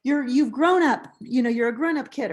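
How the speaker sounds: put-on a frustrated adult snapping at a teen; scolding teacher. Shown as a demonstration. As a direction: scold the kid like you've had enough. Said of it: "Instead of saying".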